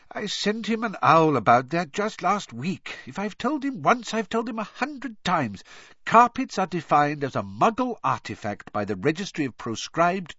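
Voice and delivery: high, weary exasperation; tired of repeating himself; exasperated father. Acted. Weary Mr Weasley.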